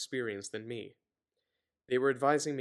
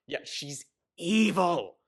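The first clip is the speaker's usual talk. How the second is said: mock outrage; 6 semitones higher, 7 dB louder, much slower than usual. Real, not acted. Mocking the villain character.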